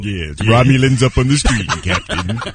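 mock starship bridge report; Lieutenant Sulu. Cohost mocking the producer as officer reporting to captain, formal. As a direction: announce it like a grave helm officer.

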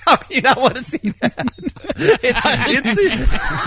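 laughing through the words; can barely talk for laughing. Real, not acted. Laughing while describing film.

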